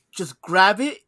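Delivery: loud retold impatient order; mad. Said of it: "he gets fucking mad at me".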